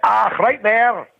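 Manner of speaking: put-on slow, high Scottish accent moan; thick Scottish accent, drawn out. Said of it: "with that thick Scottish accent".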